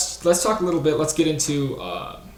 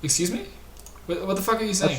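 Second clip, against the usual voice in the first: higher-pitched, incredulous challenge; mock indignation; 7 semitones higher than usual. Host baffled by the accented guest, indignant. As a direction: demand an explanation, pitch raised.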